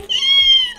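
very high squeal of discomfort; squeaky yelp. Retold or imitated. Sensitivity yelp, retold.

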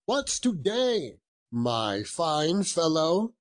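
high, drawn-out cheerful call. Acted. Scrooge repeats his question to the boy below, calling out slowly. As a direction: stretch it out to reach the street. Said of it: "calling downward to a boy".

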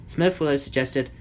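ordinary delivery

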